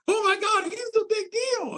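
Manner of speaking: very high, astonished gasp of a voice; imitating stunned realization. Danielle after googling Kelly, very high stunned imitation. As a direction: squeal it up high in shock.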